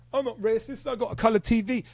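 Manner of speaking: high, loud mocking voice; mocking impression. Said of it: "That's what I hear when you're saying to me".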